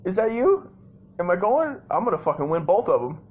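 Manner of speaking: high, loud, overexcited questions; squealed-up pretend disbelief; mock contestant excitement. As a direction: play a thrilled game-show contestant. Plays contestant heading to showdown.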